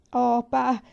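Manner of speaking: high, loud plea on the verge of tears; a child begging her father; imploring. A narrator's reading. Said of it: "implorò Laura e cominciò a piangere". (bambina che implora papà)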